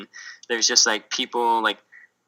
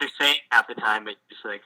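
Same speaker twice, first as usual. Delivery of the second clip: ordinary delivery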